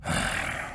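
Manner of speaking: soft, voiceless sigh. Acted. Older broodmate giving up on taunting, sighing. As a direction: let out a quiet sigh. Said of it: "I sigh".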